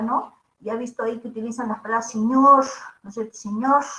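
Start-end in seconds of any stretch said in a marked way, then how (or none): 2.1-2.6 Andean sierra "señor" with a hissed final r; Andean sierra accent. Shown as a demonstration. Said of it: "así esas terminaciones, sh". 3.3-3.8 "Señor" in sierra accent, r hissed as sh; Andean sierra accent. Shown as a demonstration. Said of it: "así esas terminaciones, sh".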